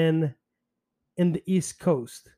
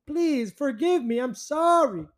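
exaggerated pleading, higher; mock begging; 9 semitones higher than usual. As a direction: whine an overdone apology. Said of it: "And I was begging".

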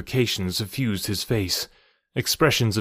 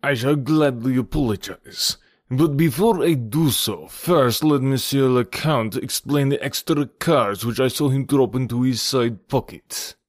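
higher, put-on French-accented player; accuser's character voice; 3 semitones higher than usual. Accuser challenges the Count before apologizing, indignant. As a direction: play the indignant card-table accuser.

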